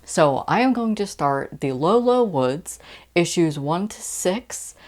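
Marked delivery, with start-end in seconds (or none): none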